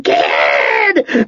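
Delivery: eerie hoarse cry of the name; hoarse whistling creature. A narrator's reading. The Gebeth calls the fleeing Ged by name, hoarse whistling cry. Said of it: "in its hoarse whistling voice".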